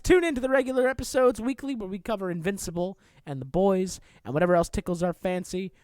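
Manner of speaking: ordinary delivery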